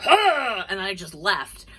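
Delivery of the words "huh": high, loud gasp; mock startled gasp. Woman discovers friend's roommate in the bed, startled gasp. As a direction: gasp sharply as if caught out. Her startled reaction on being caught.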